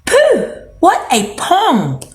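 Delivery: high squeal of disgust; harp shouting. A narrator's reading. Magic harp shouting to wake the giant, disgusted. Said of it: "the harp shouted".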